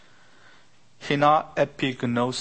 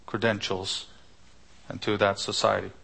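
ordinary delivery

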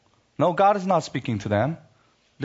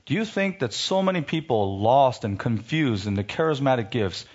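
ordinary delivery

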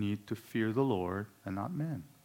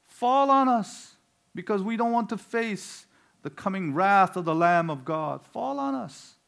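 crying out; 10 semitones higher, 9 dB louder than usual. Acted. Preacher acts the doomed pleading with the rocks, crying out high. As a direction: wail it desperately as the doomed would. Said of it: "they cry out to the rocks".

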